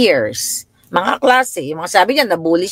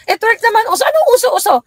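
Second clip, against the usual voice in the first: tearful shouted confrontation; faster than usual. Retold or imitated. Daughter retorts, mother mocks.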